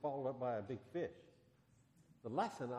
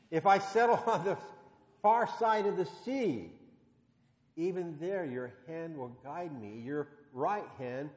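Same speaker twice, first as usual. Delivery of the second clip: scripture reading; 2 semitones higher, 6 dB louder, slower than usual. Acted. Pastor reads Psalm aloud.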